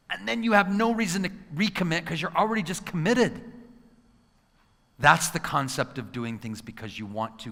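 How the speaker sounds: normal talking voice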